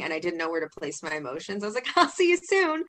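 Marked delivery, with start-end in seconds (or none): 2.0-2.8 very high, loud teary laugh; laughing and crying. Retold or imitated. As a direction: choke it out between laughs and tears. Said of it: "I was, like, laughing and crying".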